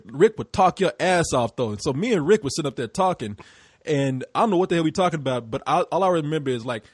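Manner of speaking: normal talking voice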